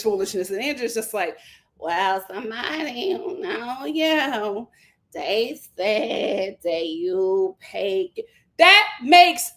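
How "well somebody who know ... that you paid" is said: slow mock Angela. Angela explaining her accusation.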